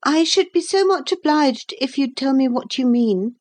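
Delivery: put-on high-pitched, well-mannered boy's voice; polite boy. A narrator's reading. Boy asking the captain to explain, polite. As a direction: request it politely as a small boy. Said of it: "and added politely".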